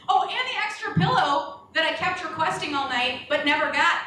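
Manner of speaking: normal talking voice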